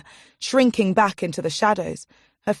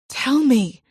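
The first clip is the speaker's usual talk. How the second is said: hissed; 6 semitones higher, 3 dB louder than usual. A narrator's reading. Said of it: "hissed Irini".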